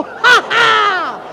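high, triumphant mock laugh; theatrical gloating cry; roared taunt. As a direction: crow "ha, ha" at a victim from hiding. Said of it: "Zařvala jsem na něho".

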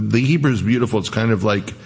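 usual speaking voice

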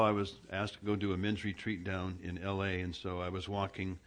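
usual speaking voice

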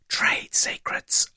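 mock-confidential whisper; secretive whisper. Sharing confidential backstage secrets on air.

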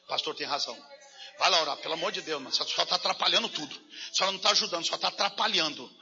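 lightly voiced brother. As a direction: rattle it off like an impatient brother. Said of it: "ele falou".

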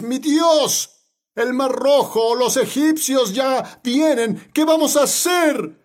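high, drawn-out acted plea of panic; panicked crying out. Israelites trapped at the sea cry to Moses, acted panic. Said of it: "y entonces clamaron con Moisés".

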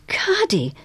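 high, falling exclamation of awe; narrated astonishment; awed. A narrator's reading. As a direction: say the name in amazement. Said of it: "said Missus Polifax in awe".

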